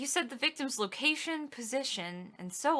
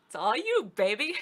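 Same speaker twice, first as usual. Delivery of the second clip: ordinary talk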